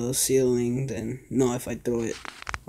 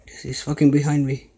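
scared horror game streamer; 9 dB louder than usual. Real, not acted. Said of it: "that jump scared me".

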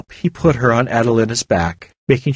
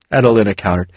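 ordinary delivery

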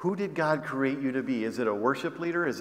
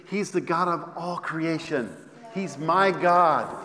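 full-force preaching; 3 semitones higher, 3 dB louder than usual. Acted.

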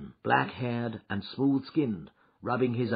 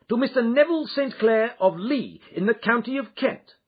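triumphant shout, much higher and louder; 8 dB louder than usual. A narrator's reading. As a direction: announce it loudly, like a reveal. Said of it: "he shouted".

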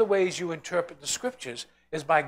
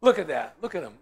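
normal talking voice

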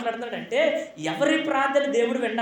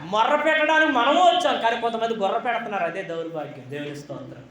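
ordinary delivery

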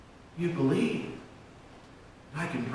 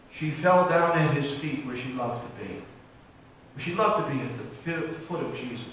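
ordinary delivery

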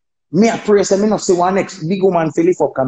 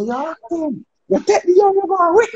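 mock hype, pitched much higher; hyped crowd voice; 12 semitones higher than usual. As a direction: imitate the people egging him on. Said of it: "the people them I say".

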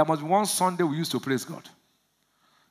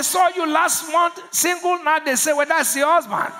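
full-force preaching; 7 semitones higher than usual. Real, not acted. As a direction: drive the congregation up.

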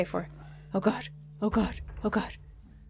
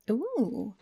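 ordinary talk

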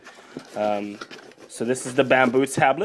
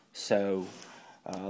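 usual speaking voice

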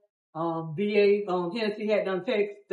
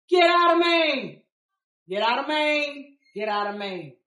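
loud warning shout; 4 semitones higher, 3 dB louder than usual. Retold or imitated. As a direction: shout the warning, fading down on the repeats. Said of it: "at a loud pitched voice".

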